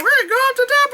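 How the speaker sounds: high, eager mock voice; mock confident. The two climbers before the climb, high eager mock voice. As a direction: sound naively determined. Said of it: "we were like".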